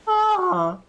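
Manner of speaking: high cupped-hand bird screech; bird call. Shown as a demonstration. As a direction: cup your hands and screech. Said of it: "really gotta wrap your hands around your face".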